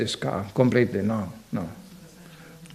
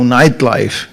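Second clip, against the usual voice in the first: ordinary delivery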